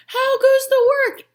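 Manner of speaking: loud, high farmer's call. Acted. Farmer calling from bed.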